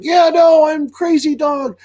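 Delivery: very high, loud goofy dog voice, slowing down; hammed-up hyper dog squeal; crazy dog. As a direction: play a wildly amped-up dog. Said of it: "your dog just like".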